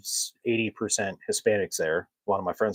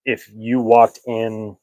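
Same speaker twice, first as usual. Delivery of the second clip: ordinary talk, nothing marked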